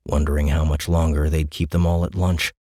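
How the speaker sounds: usual speaking voice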